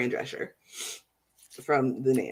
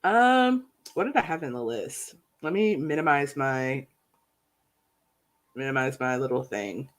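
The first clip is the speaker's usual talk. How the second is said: stuffy, congested voice; blocked nose; 2 semitones lower, 3 dB quieter than usual. Real, not acted. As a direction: talk through a blocked nose. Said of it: "You sound so sniffly".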